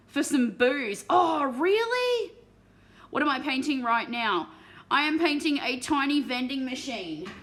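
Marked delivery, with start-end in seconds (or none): 1.1-2.3 high, loud, drawn-out disbelief; loud surprise. Real, not acted. Reacting to terrain swapped for booze. 3.1-7.3 loud, emphatic statement; loud announcement. Real, not acted. Announcing what she is painting.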